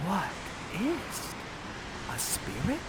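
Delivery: soft, awed murmur; whisper to yourself. Acted. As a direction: breathe it out in wonder. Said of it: "you whisper to yourself".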